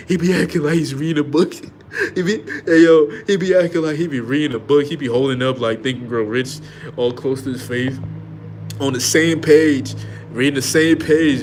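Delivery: mocking. Comedian mocking a man faking reading, loud and high. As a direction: ham it up, poking fun at him.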